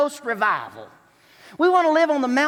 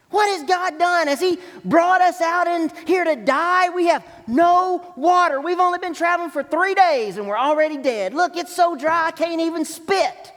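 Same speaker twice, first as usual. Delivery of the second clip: whiny griping complaint, pitched higher; complaining; 6 semitones higher, 3 dB louder than usual. Retold or imitated. Israelites complaining to God in the desert, whiny griping. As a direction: gripe like a grumbling traveler in the desert.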